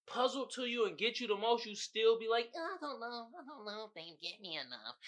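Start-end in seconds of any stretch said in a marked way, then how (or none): 2.6-5.0 soft doubtful imitation. Voicing doubting partner.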